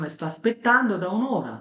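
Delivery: slow, carefully articulated Italian with lively Italian melody; Italian demo speech. Shown as a demonstration. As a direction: show how the mouth moves in Italian. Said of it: "বললাম".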